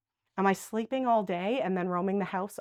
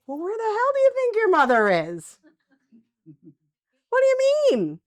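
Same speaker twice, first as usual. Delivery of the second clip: high, louder voice of a confused resident; anxious old parent asking where mom is; confused elder; 10 semitones higher, 5 dB louder than usual. Acted. A confused resident asks the caregiver, anxious and high.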